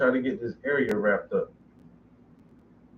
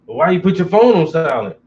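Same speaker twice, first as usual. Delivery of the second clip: louder, indignant question; imitating annoyed caller; 8 dB louder than usual. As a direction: put on the nagging friend's complaint. Voicing annoyed texter.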